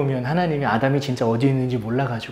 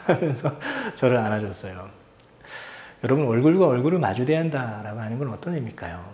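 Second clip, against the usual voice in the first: normal talking voice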